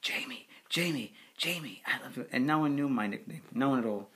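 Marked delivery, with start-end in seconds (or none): 0.0-2.3 imitating a mother's whispered call; hushed, whispery spirit of a mother. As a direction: whisper it tenderly, as his mother. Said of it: "came through in her voice".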